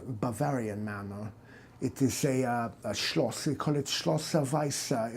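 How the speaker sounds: a soft-spoken German doctor; German accent. Acted. German doctor telling his family story, in a German accent. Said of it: "Your stupid accent".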